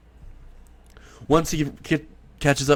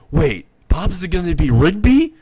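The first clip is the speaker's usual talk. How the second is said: surprised Benson; 6 semitones higher, 4 dB louder than usual. Acted. Benson shocked by the news.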